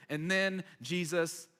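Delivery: ordinary talk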